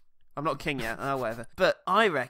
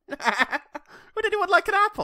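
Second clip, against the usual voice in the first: silly high voice; 6 dB louder, slower than usual. Pretend.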